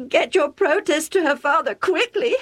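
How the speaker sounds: mother urging husband to stop the wedding, tearful; sobbing. A narrator's reading. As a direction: plead through tears. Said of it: "Rebecca sobbed".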